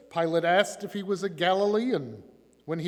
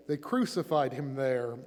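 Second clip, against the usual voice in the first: usual speaking voice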